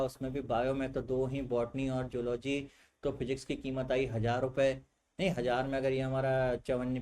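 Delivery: low, soft student totting up costs; mock a student working out wasted fees under the breath; grumbling student. Acts student grumbling over fee maths.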